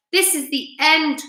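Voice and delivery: a touch of panic; mild despairing cry. A narrator's reading. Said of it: "cried the old green grasshopper".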